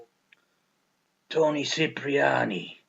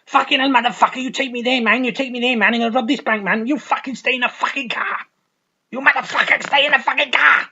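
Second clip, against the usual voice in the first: high, loud furious yelling; shrill bank-job rant, way up high and loud; crazed villain yelling; 10 semitones higher, 6 dB louder than usual. Retold or imitated. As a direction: scream orders at him like a psycho. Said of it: "and she's like".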